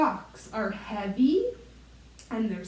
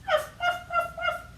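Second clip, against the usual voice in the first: very high little yaps; squeaky puppy ruffs; small dog yap. Pretend. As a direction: yip like a tiny dog. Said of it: "a small dog with a bark like".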